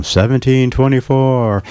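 lightly voiced old ghost, dazed and slow. Author plays a confused ghost answering a medium, slow.

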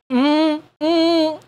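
humming ah with lips shut; closed-mouth ah. Shown as a demonstration. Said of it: "try and say, "Ah," with your mouth closed".

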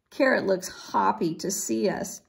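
plain reading voice

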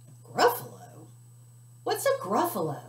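high, loud startled squeak; surprised fox. Acted. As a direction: jump at the strange word.

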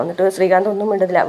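normal talking voice

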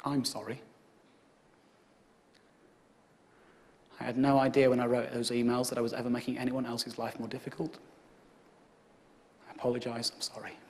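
low, soft contrite murmur; quiet apology. Retold or imitated. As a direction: apologise softly.

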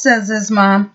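ordinary delivery, nothing marked

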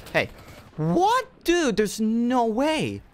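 high, loud, slow drawn-out disbelief; outraged disbelief. Real, not acted. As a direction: stretch it out in shocked outrage. Dies unexpectedly.

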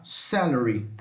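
"Celery" stressed on the first syllable, pitch dropping away; teacher's word drill on "Celery"; pronunciation demo. Shown as a demonstration. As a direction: model "Celery" carefully, pitch falling. Teacher models English word repeatedly.

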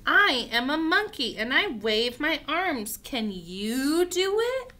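bold picture-book read-aloud; reading to children. Acted. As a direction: read it out clearly and invitingly to little ones.

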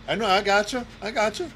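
usual speaking voice

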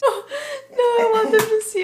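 high, loud, breaking up with laughter; can't stop laughing, tears coming. Real, not acted. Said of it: "I, I started crying".

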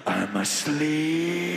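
long, low snore forming words; snoring noise. Acted. Snorer reminds the one awake, rumbling snore. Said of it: "how do you people make these noises".

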